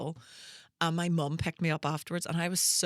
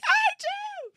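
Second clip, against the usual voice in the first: squeaky, much higher, eager "I do"; eager squeak; 5 dB louder than usual. Retold or imitated. Fan volunteering to the singer, eager high squeak. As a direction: leap in as the keen fan, high and bright.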